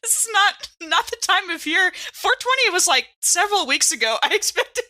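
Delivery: high, animated joking; excited. Real, not acted. Player jokes excitedly that it's not 420 season.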